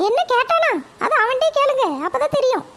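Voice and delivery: high, sharp-voiced village mother answering her mother-in-law; mother's voice. Mother answers her mother-in-law, high and curt. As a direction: pitch it up as the boy's cranky mother.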